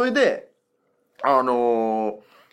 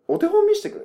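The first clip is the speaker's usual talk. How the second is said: eager request; 6 dB louder than usual. Retold or imitated. Engineer asks for demo.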